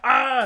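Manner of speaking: short high imitated war cry; mock battle roar. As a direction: lightly ham up her roar. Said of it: "a battle roar".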